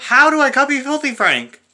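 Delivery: high-pitched, indignant comeback; indignant protest. Real, not acted. Answering a heckler who accuses him of copying, indignant.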